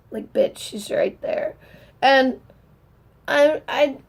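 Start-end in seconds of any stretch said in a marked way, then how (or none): 0.1-1.6 breathy near-whisper, much softer; whispered aside. Real, not acted. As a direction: drop to a hushed whisper as if to yourself. Fan overwhelmed by her bias.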